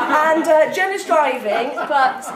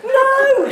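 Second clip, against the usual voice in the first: high, loud wailed "no"; 12 semitones higher, 7 dB louder than usual. A narrator's reading. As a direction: wail it out in acted agony. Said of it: "she wailed".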